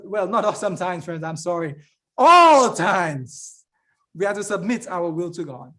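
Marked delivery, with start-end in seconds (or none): 2.2-3.6 "All" punched loud; emphatic correction. Real, not acted. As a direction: hit "All" hard as a correction. Emphatic self-correction of sometimes.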